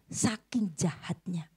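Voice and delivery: slow, breathy whisper; hushed aside. Acted. Preacher whispers to the congregation how evil the thug was, slow. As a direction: drop to a slow whisper for effect.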